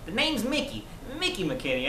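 Mickey McKinney. Shown as a demonstration. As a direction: start very high, drop on the surname. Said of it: "more and more high-pitched".

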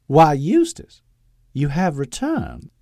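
high, loud sing-song proclamation; sing-song Southern lady. A narrator's reading. Mother greeting her son from the balcony, sing-song. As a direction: lilt it like a genteel belle. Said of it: "she proclaimed in a sing-song voice".

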